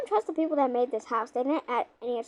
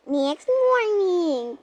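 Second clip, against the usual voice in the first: announcer call; 4 semitones higher, 5 dB louder, much slower than usual. Pretend. Announcing time jump in play.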